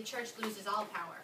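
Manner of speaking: normal talking voice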